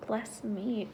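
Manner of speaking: ordinary talk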